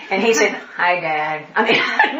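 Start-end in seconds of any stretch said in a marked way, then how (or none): 0.8-1.5 no enthusiasm. Retold or imitated. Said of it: "no enthusiasm".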